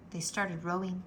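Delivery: ordinary delivery, nothing marked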